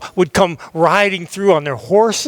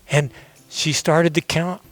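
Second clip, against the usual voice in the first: plain reading voice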